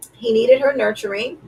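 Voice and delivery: ordinary delivery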